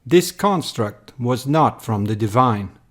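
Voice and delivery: usual speaking voice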